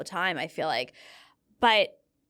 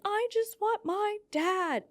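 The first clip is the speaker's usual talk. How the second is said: imitating a sobbing little girl, much higher and slow; crying little girl; 11 semitones higher, 4 dB quieter, slower than usual. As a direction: whimper it like a small child in tears. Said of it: "crying and screaming".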